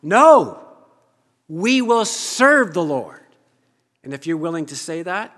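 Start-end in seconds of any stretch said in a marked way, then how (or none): 0.0-3.3 loud, slow emphatic vow; people's shout. Acted. People answering Joshua, loud emphatic vow. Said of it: "the people said to Joshua".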